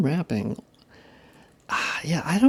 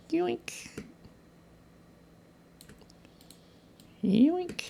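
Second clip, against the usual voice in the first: squeaky yoink; 8 dB quieter than usual. Pretend. Grabbing a mesh piece.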